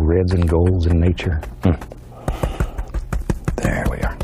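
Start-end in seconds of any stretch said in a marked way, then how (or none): 3.5-4.2 whispering. Real, not acted. Soft aside while painting.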